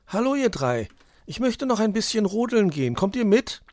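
high, loud little fox voice; Weißohr, eager little fox. Acted. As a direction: invite friends along in a high, bright-eyed voice.

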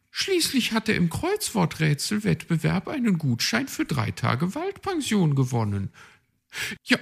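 chirpy, self-assured magpie; high magpie voice. A narrator's reading. Magpie explains her plan, high bossy voice.

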